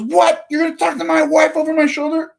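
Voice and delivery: mock outraged homeowner. Homeowner offended that he speaks to his wife, mock outraged. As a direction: ham up his offended disbelief. Angry homeowner voiced in retelling.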